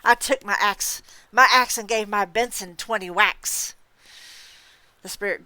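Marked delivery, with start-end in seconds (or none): none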